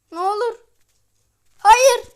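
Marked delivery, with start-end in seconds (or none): 1.6-2.1 a sudden high, loud yell of no; shouted no. Real, not acted. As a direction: yell it as the round slips away. Oyunda kaybederken bağırıyor. (yüksek sesle çığlık atarak hayır)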